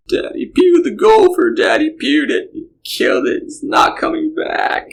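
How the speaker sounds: put-on high, slow wailing child voice; mock child bawling. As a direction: imitate a sobbing four-year-old. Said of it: "and just bawling".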